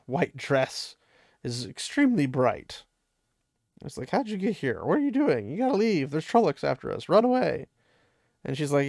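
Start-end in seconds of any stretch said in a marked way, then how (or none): 4.1-7.7 high-pitched mock-frantic urging; retold urgent warning. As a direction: act out a panicked plea to flee, pitched up.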